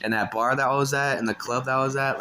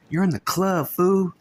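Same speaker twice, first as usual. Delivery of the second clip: angry scolding; 8 semitones higher, 4 dB louder, slower than usual. Retold or imitated.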